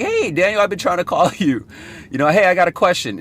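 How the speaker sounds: high, bright mock caller voice, quick at the end; imitating the prospect. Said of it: "was like".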